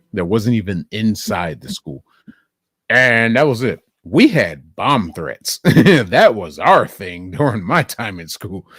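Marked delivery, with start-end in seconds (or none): none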